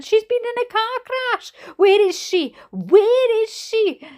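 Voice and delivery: screaming mother. A narrator's reading. Mother demanding her sister at reception, screaming. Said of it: "screamed my mother".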